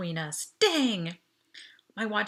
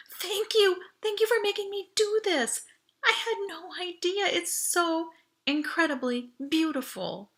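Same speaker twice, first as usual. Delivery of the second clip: high, breathless, overjoyed thanks; thrilled gushing; 10 semitones higher than usual. A narrator's reading. Audrey overwhelmed by the starry sky, gushing high with joy. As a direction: burst out with delight. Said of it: "Audrey gasped and threw her arms".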